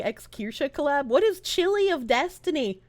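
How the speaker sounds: usual speaking voice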